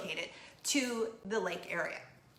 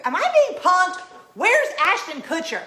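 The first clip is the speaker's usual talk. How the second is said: much higher and louder, laughing it off in shock; loud disbelief; 8 semitones higher, 9 dB louder than usual. Retold or imitated. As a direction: shout it like it's a prank. Disbelief at the officer's visit.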